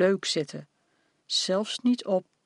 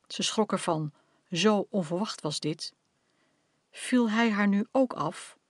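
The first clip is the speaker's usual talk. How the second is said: ordinary delivery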